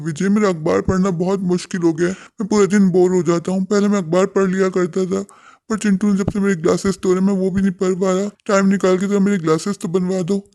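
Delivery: put-on a lonely old father calling for his family. (ऊँची आवाज़ में शिकायती बूढ़े पिता)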